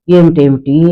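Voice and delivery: plain reading voice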